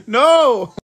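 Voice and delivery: high loud laughing protest. Real, not acted. Protesting partner's guilt trip.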